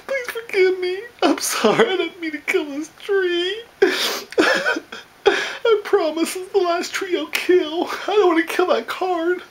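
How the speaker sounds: high-pitched, hammed-up tearful pleading; mock crying. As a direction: beg forgiveness through exaggerated tears. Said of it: "I'm just crying".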